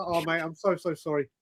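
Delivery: sheepish apology. Retold or imitated. Embarrassed traveller apologising to the baggage handler, softly sheepish. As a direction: mumble it like you've just been caught out. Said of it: "I was like".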